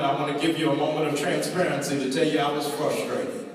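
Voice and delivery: normal talking voice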